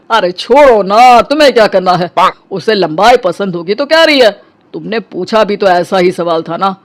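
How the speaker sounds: usual speaking voice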